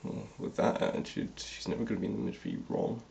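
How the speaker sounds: rapid whisper, teasing Ron; fast whispered aside. Real, not acted. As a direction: rush it out in a sly whisper.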